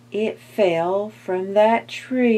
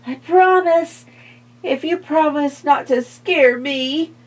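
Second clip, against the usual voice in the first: skeleton voice; 9 semitones higher, 7 dB louder than usual. A narrator's reading.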